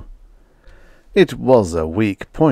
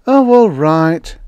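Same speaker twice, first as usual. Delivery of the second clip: resentful; 9 semitones higher, 7 dB louder, slower than usual. A narrator's reading. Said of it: "Slim rose resentfully".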